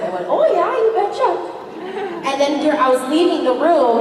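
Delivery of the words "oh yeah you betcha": high, slow Minnesota "oh yeah, you betcha"; Minnesota accent. Retold or imitated. A Canadian actor showing the director her Minnesota accent. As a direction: stretch it out in a broad Minnesota accent. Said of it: "I'm gonna need you to do the accent".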